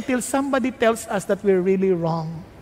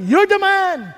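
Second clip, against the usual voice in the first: loud, higher accusation; loud prophet's accusation; accusing; 7 semitones higher, 4 dB louder, slower than usual. Acted. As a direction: declare it to his face. Nathan confronts David.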